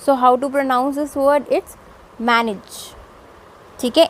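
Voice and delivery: usual speaking voice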